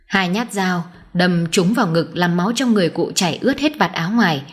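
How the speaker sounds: ordinary delivery, nothing marked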